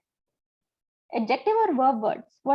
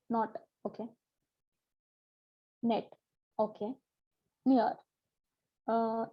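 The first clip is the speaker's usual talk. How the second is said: usual speaking voice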